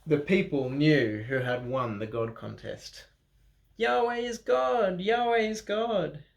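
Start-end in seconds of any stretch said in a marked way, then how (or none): 3.8-6.2 crowd cheering. Acted. Crowd cheers after fire contest.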